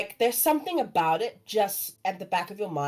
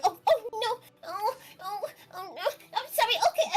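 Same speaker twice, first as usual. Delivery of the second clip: high, panicky squeak breaking into near-sobs; a tiny fish fretting and apologizing; flustered little fish; 12 semitones higher than usual. Acted. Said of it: "and going".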